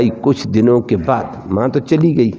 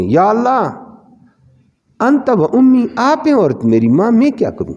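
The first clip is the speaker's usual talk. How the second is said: torn, pleading prayer; 7 semitones higher than usual. Retold or imitated. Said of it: "تردد میں".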